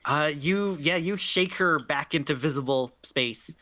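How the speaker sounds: ordinary talk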